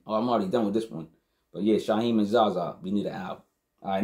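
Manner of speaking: ordinary talk, nothing marked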